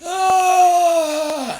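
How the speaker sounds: sudden high loud cry of alarm; startled scream. Retold or imitated. Waking to a worse rash, a high startled scream. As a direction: shriek as you see your skin.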